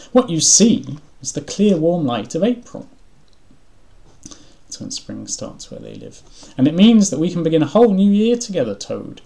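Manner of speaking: high, loud, enthusiastic character voice; eager Frog. A narrator's reading. Frog urging Toad to greet spring, eagerly. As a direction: announce spring with storybook eagerness.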